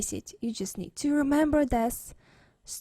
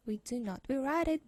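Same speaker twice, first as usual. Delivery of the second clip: ordinary talk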